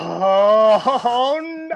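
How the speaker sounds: long loud "oh" climbing in pitch; acted-out horrified cry, drawn out; retold panicked yell. Retold shock at cop while undressed.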